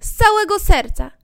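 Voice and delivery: high, loud eager yes; eager cry. Acted. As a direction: answer gladly like a grateful girl. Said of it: "zawołała dziewczę". (radosny, wysoki okrzyk)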